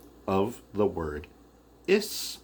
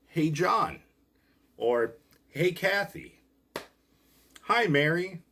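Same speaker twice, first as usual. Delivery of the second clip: calling out names; 8 semitones higher, 4 dB louder than usual. Shown as a demonstration. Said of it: "you're calling out someone's name".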